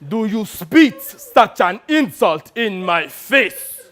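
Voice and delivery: theatrical, insistent demand; yelled repeat. Acted. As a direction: hit SPIT hard, drawn out and affronted. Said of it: "it took Kayode yelling at me".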